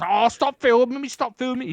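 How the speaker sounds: high-pitched mock protest; aggy. Man protesting to the cameraman filming him, mock aggy. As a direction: imitate an agitated man objecting, pitched well up. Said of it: "the person gets aggy".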